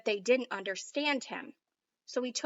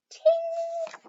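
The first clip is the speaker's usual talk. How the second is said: a much higher, thin "ting" like a tiny bell; bell chime; 4 dB louder than usual. A narrator's reading. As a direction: ring out "ting" high like a bell. Chime at end of refrain.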